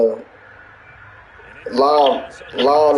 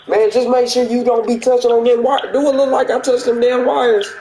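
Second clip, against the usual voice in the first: mocking warning; 9 semitones higher than usual. Coworker's warning, then retort.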